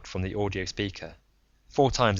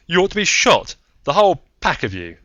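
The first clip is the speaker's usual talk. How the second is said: loud, higher angry outburst; furious; 10 semitones higher, 11 dB louder than usual. Acted. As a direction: blast them with outrage. Anderton furious at the CIA.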